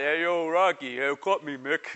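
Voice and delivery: a hint of a mumbling boxer calling his trainer; teasing his wife with a light Rocky voice; Rocky impression. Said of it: "she looked like Rocky".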